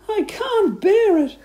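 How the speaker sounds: high, loud, drawn-out wail; shrieky despairing wife; crying out. A narrator's reading. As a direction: wail it slowly in a high panicked voice. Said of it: "cried Missus Twit".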